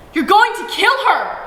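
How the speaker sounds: high, loud frantic cry; shout. A narrator's reading. Mother shouting at father to stop the beating, high frantic shout. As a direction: scream to stop him before it's too late. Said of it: "Uma shouts".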